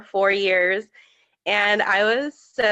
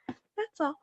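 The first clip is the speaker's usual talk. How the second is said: teary laugh; 8 semitones higher, 12 dB quieter than usual. Real, not acted. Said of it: "I started crying".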